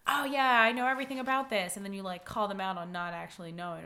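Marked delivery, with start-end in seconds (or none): 0.1-1.7 loud, higher imitation of a show-off; mock know-it-all. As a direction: overplay someone claiming total expertise. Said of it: "dealing with someone who's like".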